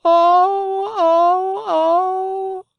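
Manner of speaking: loud, very high drawn-out wails; despairing wail. Acted. Pinocchio wishes to die, loud high-pitched wailing. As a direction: wail "oh" in theatrical grief.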